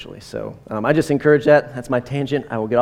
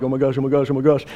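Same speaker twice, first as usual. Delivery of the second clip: mock fan freakout; faster than usual. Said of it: "I'm not freaking out like".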